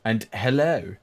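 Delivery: ordinary talk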